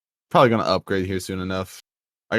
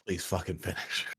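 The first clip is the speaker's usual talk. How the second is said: muttered aside; 2 semitones lower, 15 dB quieter than usual. Retold or imitated.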